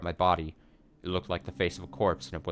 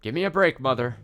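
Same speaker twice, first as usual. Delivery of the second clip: higher, louder exasperated complaint; irritated; 6 semitones higher, 6 dB louder than usual. Acted. Mom fed up with grandma's praying, irritated. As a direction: snap at her in irritation. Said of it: "with an irritated look on".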